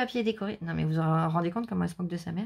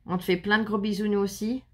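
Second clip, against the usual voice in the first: ordinary talk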